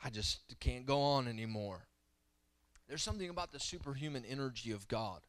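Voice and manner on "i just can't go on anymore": despairing sigh. Retold or imitated. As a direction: let the words sag in exhaustion. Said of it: "Where you're like".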